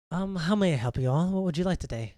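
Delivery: a hint of a friendly waitress greeting customers; mild shop-girl politeness; polite waitress. GM voices the waitress.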